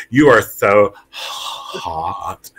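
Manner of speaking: "You are so hot" stretched out very slow and soft; drawn-out flirty caller voice; slow feminine "so hot". Shown as a demonstration. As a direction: coo it slowly like the prank caller. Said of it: "like that?".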